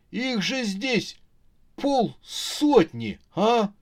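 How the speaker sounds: high, drawn-out disbelief; daunted mumbling. Acted. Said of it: "промямлил Марк". (растерянно, протяжно и высоко)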